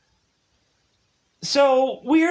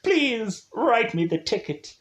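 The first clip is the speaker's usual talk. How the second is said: whiny plea; 7 semitones higher than usual. Retold or imitated. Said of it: "But Allison is like".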